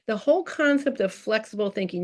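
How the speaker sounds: ordinary talk, nothing marked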